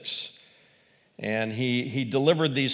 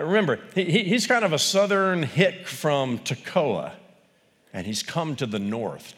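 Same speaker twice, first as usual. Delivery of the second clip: normal talking voice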